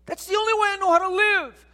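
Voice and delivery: high, flustered objection; protesting listener. Retold or imitated. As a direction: sputter it out like a confused churchgoer. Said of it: "You say".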